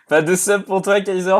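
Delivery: ordinary delivery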